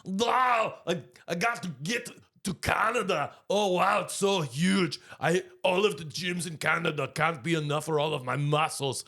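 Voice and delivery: high mock Austrian-Russian woman voice; impressing a Russian woman through Arnold's voice; Schwarzenegger as Russian woman. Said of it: "This is my impression of Arnold Schwarzenegger impersonating the Russian woman".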